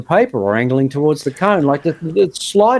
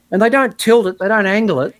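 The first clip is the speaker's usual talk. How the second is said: normal talking voice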